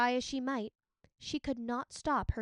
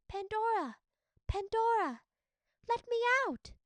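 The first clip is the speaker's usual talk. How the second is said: much higher, louder tiny calling voice; tiny kitten-like voice; 10 semitones higher, 4 dB louder than usual. A narrator's reading. Creature in the box calling Pandora, tiny and high. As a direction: cry out like a trapped baby kitten. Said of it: "It was a tiny voice, like the cry of a baby kitten".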